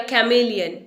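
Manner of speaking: "कैमेलियन" with a full kai at the start; wrong pronunciation. Shown as a demonstration. Teacher offering another wrong form, full first vowel. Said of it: "ना इसे कैमेलियन".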